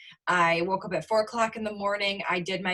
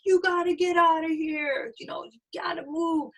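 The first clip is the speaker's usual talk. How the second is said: higher-pitched imitation of an airport guy; mock hurry-them-along order, voice pitched up; 9 semitones higher than usual.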